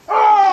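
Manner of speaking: loud high gasp at the cold; real loud gasp. Real, not acted. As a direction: gasp as the snow hits. Gasp stepping into snow.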